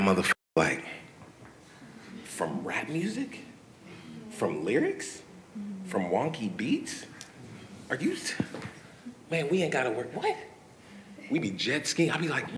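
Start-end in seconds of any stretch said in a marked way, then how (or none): 2.2-10.4 high, soft, incredulous questions; softly squeaked mock astonishment; hushed disbelief. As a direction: act out marveling under your breath at your luck. Acting out his amazement at success.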